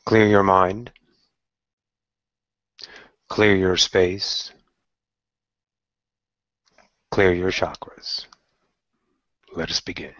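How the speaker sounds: slow, calm meditation voice with long pauses; guided meditation. Acted. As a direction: lead them gently into stillness, phrase by phrase.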